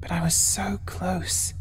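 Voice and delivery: slow, breathless whisper, close to tears; hushed tearful whisper. A narrator's reading. Sadie whispering to Oliver how close she came, hushed. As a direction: let it slip out as a whisper.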